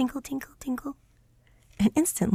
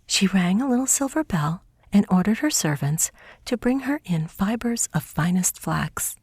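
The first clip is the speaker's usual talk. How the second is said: plain reading voice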